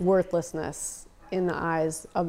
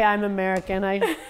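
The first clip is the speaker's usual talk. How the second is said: ordinary talk, nothing marked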